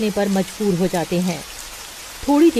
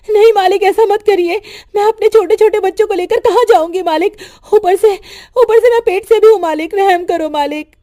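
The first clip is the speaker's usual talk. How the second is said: much higher and louder, frantic begging; desperate pleading; 10 semitones higher, 8 dB louder than usual. Acted. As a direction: cry out for mercy, near panic. Desperate plea to landlord.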